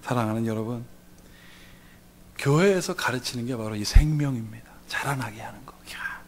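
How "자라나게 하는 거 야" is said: hushed, whispered preaching; soft intimate whisper. Acted. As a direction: lean in and whisper it.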